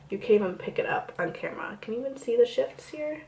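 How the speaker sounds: soft unvoiced whisper. Real, not acted. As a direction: whisper it to the camera. Quiet aside to camera.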